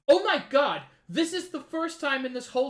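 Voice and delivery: loud, thrilled outburst; excited. Real, not acted. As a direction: blurt it out in delight. Excited reaction to series moment.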